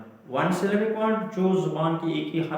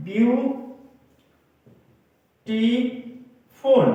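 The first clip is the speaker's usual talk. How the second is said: "Beautiful" as view... टी... फुल, long pauses between; word broken up; 5 semitones higher, much slower than usual. Shown as a demonstration. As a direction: break "beautiful" into three very slow, raised beats. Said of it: "किसी लफ्ज को तोड़ें". (Beautiful को तोड़कर, हर syllable पर रुककर)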